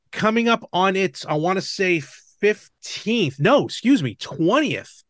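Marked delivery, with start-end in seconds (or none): none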